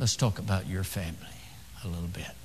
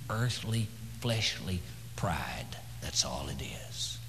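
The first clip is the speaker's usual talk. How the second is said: slow whisper, much lower and softer; sinking whisper; 3 semitones lower, 7 dB quieter, slower than usual. Acted. Preacher condemns pride, sinking to a hushed whisper. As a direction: let the scorn drain into a whisper.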